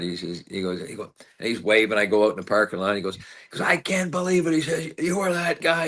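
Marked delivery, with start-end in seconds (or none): 3.6-5.9 much higher, gushing disbelief; imitating an awestruck fan; starstruck fan. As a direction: squeal it like a thrilled fan. Fan meets him in lot.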